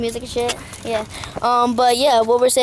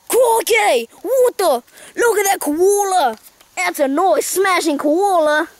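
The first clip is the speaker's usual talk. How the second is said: higher, excited mock Aussie exclaiming; amazed Australian host; 6 semitones higher, much slower than usual. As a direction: marvel at a wildlife sighting. Host suddenly spots a koala.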